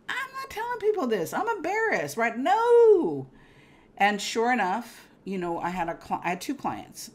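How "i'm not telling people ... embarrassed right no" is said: high-pitched mock protest. Embarrassed refusal, mock protest.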